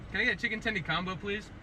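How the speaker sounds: ordinary talk, nothing marked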